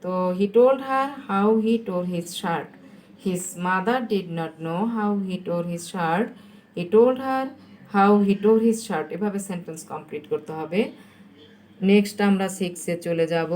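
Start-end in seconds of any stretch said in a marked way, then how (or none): 0.4-9.1 very slow, measured read-out; slow dictation. Shown as a demonstration. Teacher dictates the completed answer to pupils, very slowly. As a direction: dictate it word by word.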